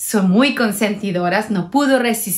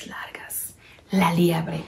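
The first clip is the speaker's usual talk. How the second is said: whispered reveal of the hare; 7 semitones lower, 7 dB quieter than usual. A narrator's reading. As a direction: whisper it like a secret. Narradora susurra a la liebre. (susurrando el nombre de la liebre)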